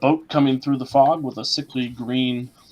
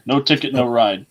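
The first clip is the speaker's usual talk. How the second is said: gruff ferryman; 8 dB louder than usual. Acted. Ferryman voiced by GM.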